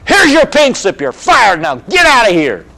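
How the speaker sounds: imitating a rest-home resident yelling; angry old man. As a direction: bark it like a furious boss firing someone. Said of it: "and he goes".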